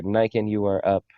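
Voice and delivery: ordinary talk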